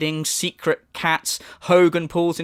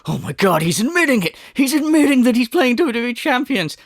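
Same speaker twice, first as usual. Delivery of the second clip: higher, louder hammed-up outrage; mock outraged detractor; 7 semitones higher, 3 dB louder than usual. Commentator voicing seething detractors, hammed-up shrill outrage. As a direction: play a hysterical fan, overdone. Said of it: "detractors are seething, going".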